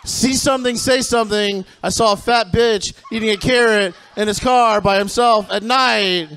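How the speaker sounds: hammed-up see-something-say-something call; mock safety announcement.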